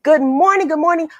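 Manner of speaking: ordinary delivery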